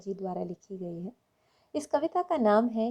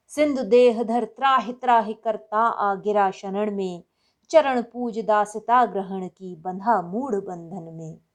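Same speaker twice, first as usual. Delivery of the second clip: loud, emphatic Hindi verse recitation; ringing, measured delivery of heroic verse; poem recitation; 7 dB louder than usual. Acted. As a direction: declaim the heroic poem with force, punching key words.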